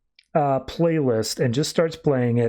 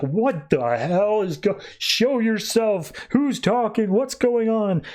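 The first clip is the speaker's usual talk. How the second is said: higher, drawn-out panicked questioning; mock freak-out, pitched up; acted bewildered demand; 7 semitones higher, slower than usual. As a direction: call out to an unseen voice, confused. [name] shouting at unseen voice.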